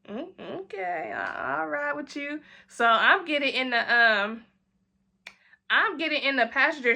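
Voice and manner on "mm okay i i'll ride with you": coy agreement. Retold or imitated. Agreeing to ride with Chris, soft and coy. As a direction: shrug into yes softly.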